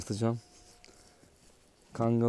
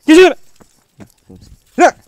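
far higher, full-voiced yell; high furious shout; 18 dB louder than usual. Real, not acted. Ordering the dog to get in front, furious shout. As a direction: scream the order out.

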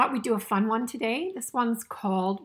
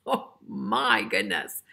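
ordinary delivery, nothing marked

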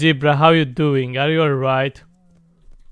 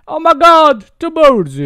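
high, loud excited exclamation; excited high-pitched cry; delighted surprise; 11 semitones higher, 6 dB louder than usual. Real, not acted. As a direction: exclaim high at a happy discovery. Spots two birds in the game.

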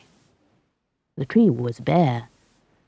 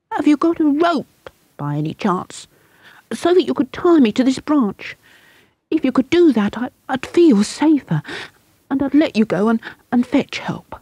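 put-on higher, halting scared boy; frightened boy; 9 semitones higher than usual. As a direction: ask nervously, stumbling.

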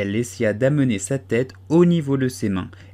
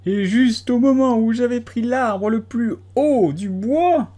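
high, slow, louder complaint; rising pigeon lament; 11 semitones higher, 5 dB louder, slower than usual. A narrator's reading. Pigeon ranting to Alice, rising voice. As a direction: build toward a shriek.

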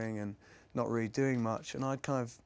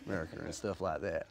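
American Southern accent; 5 semitones lower, 7 dB quieter than usual. Shown as a demonstration. Actor demonstrating an accent for his next film, Southern US accent. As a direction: talk like you're from down South, pitched low. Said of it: "I can't talk like this".

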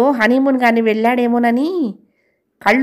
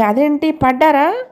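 startled concern, higher and slower; worried at the sight of her bandage; alarmed; 5 semitones higher than usual. A narrator's reading. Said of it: "Hemant కంగారు పడి".

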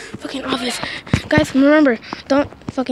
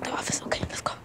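hushed, breathy whisper; 13 dB quieter, faster than usual. Real, not acted. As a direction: whisper it so nobody hears. Sneaking to knock office.

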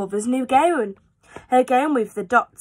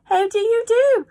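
Things greeting; 11 semitones higher, 4 dB louder than usual. Acted. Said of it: "They said".

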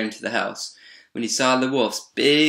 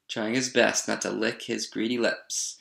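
ordinary delivery

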